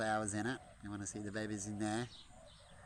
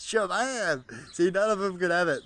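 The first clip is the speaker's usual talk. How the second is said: high, loud gleeful banter; playful loud teasing of the flock; excited teasing; 9 semitones higher, 14 dB louder than usual. Real, not acted. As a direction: gloat at the birds. Teasing the birds.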